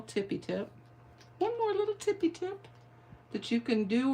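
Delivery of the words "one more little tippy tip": very high, squeaky little voice; tiny playful voice. Pretend. Crafter teasing one more little tip, tiny high voice. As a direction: repeat it cutely, pitched way up.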